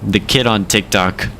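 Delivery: ordinary talk, nothing marked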